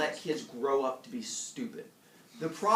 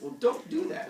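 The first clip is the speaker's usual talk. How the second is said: mild scolding elder; 4 dB louder than usual. Retold or imitated.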